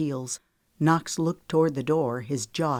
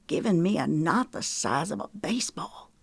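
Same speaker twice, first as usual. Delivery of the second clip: grumbling. A narrator's reading. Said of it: "he grumbled".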